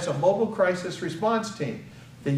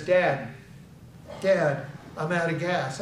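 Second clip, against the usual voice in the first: ordinary talk, nothing marked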